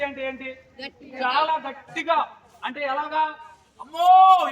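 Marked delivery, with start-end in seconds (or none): 3.8-4.5 loud, high cry of alarm; demonstrated shout of horror; shocked cry. Shown as a demonstration. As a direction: cry out as if at something terrible. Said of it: "మనం అరుస్తాం కదా".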